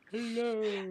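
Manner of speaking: high-pitched put-on hello; goofy mock hello. Guest echoing hosts' teasing greeting, high goofy hello. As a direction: call the greeting out high and silly. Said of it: "all I thought was Seinfeld".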